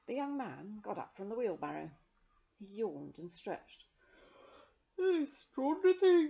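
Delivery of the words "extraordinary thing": high, loud, bright-voiced surprise; cheerful exclaim. Young man waking on the wheelbarrow, cheerful and loud. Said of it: "he said cheerfully".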